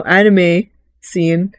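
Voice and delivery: ordinary talk, nothing marked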